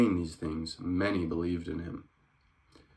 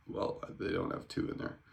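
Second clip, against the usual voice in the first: whispered aside; 8 dB quieter than usual. Real, not acted. Aside while reading.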